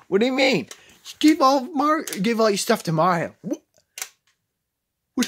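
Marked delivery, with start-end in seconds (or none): none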